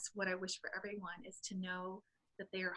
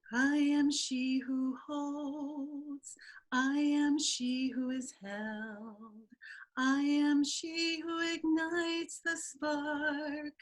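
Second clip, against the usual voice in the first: very slow, high, breathy chant; slow breathy incantation, higher and louder; 7 semitones higher, 11 dB louder, much slower than usual. Acted. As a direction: breathe each line out like a sung prayer. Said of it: "Here's my breathy voice".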